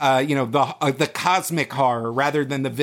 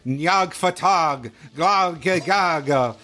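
very slow, drawn-out cultist invocation; mock eldritch incantation; 3 semitones higher, much slower than usual. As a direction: intone alien words like a summoning ritual. Mock incantation to Cthulhu as sign-off.

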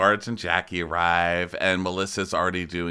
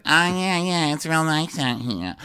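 much higher, gawking voice; Teresa impression; 8 semitones higher, 3 dB louder than usual. Teresa pointing at the trash barge, much higher. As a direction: gawk at it, way up high. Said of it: "Teresa's like".

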